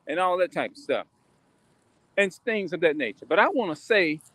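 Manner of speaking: ordinary delivery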